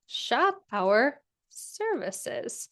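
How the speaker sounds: very slow, drawn-out words with gaps; stretched out word by word while typing; typing aloud. Real, not acted. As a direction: say each word slowly as you type it.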